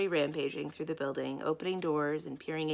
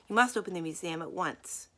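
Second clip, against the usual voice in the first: usual speaking voice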